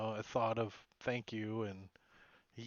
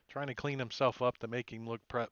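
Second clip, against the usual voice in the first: ordinary talk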